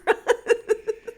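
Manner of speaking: delighted high laugh; real laughter while telling the story; high burst of laughter. Real, not acted. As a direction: laugh out loud at the memory. Laughs at the memory.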